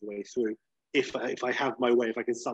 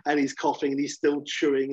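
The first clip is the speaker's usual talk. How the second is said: ordinary delivery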